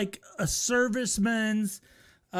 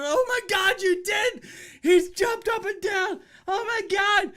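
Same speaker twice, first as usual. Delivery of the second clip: higher, louder "oh my God"; shocked exclaiming; 8 semitones higher, 4 dB louder than usual. Retold or imitated. Friend reacting to the jogger jumping around, higher and louder. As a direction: gasp it out in disbelief. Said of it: "And he looked at her".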